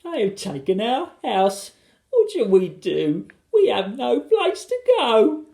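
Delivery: high, slow tearful wail; sobbing. A narrator's reading. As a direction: lament in drawn-out despair. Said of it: "she sobbed".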